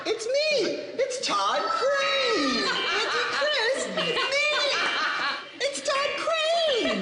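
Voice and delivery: silly high voice laughing. Pretend. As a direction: squeak the name through giggles. Prank voice again, laughing.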